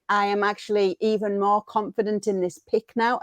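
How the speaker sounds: ordinary talk, nothing marked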